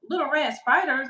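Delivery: retold baffled reaction; incredulous echo. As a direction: repeat it back in mock disbelief. Acting out her disbelieving reply.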